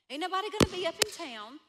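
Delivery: quick eager sales pitch; rushing through the plan; fast. Acted. Pitching the bank robbery plan.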